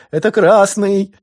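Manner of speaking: very high, loud, slow squeaky cry; squeaky shout. A narrator's reading. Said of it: "каким-то писклявым голосом кричит он". (пискляво, громко и медленно)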